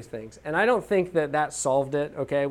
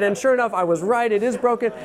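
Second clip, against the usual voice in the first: mildly hammed-up dad self-defence, pitched up; exasperated insistence; 6 semitones higher, 4 dB louder than usual. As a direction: argue your point to a child, a touch exasperated. Exasperated dad insisting he was right.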